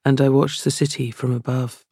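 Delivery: an author's reading, unhurried and steady; reading aloud. Acted. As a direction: read it like a confession to the reader. Author reads her novel aloud.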